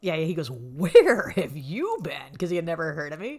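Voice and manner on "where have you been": loud, very drawn-out question; amazed mock demand. Comic who had never heard of her, loud drawn-out amazement. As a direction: stretch each word in exaggerated disbelief.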